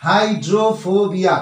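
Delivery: slow hydrophobia split into হাই-ড্রো-ফো-বিয়া; slow syllable by syllable. Shown as a demonstration. Teacher showing students the four syllables, slowly. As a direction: draw out hydrophobia slowly, one syllable at a time. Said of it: "শব্দাংশ তাতে ভেঙে নিতে হবে".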